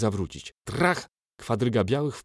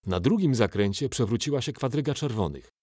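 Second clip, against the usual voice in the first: plain reading voice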